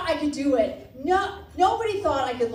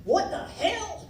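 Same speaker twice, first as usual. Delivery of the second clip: higher-pitched, put-on smoker's voice; smoker dad impression; 6 semitones higher than usual. Smoker dad reacting to daughter's plan, mock baffled. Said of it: "That's how he talked. He smoked".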